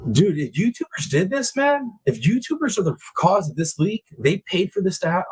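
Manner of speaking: indignant outburst at YouTubers; outraged. Real, not acted. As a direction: pitch climbing in disbelief. Rising outrage at leakers.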